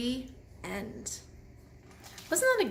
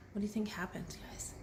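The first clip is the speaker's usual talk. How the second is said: ordinary delivery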